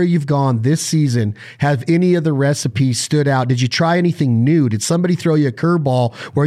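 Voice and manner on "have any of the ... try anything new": ordinary delivery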